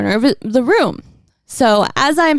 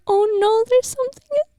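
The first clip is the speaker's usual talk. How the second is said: high, put-on scared voice; mock scared; 14 semitones higher than usual. Wife retelling nightmare to friends, high mock fear. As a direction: say it like a spooked sleepy girl.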